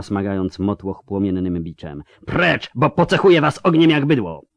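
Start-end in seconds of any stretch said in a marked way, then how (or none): 2.3-4.4 high, forceful threat; furious threat. Acted. Yennefer threatens the crowd, higher and furious. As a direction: threaten them like branding cattle. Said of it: "wrzasnęła".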